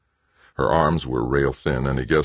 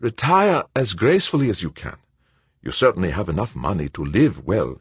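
put-on higher, quicker voice for the anxious old friend; Verban, worried old colleague; 4 semitones higher, faster than usual. A narrator's reading. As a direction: voice Verban as tense and urgent. Verban's voice in the read story.

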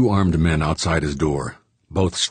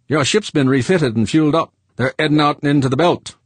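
higher, louder blunt miner; rough-edged George, raised voice; gruff George; 10 semitones higher, 5 dB louder than usual. A narrator's reading.

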